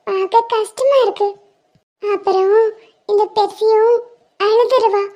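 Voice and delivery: put-on a tiny girl promising to stay; sweet child reassuring her friend; little girl voice. Plays little girl Percy.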